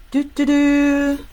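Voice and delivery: slow, high, loud vocal fanfare; mock fanfare. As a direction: toot it out triumphantly. Mock fanfare after the rule.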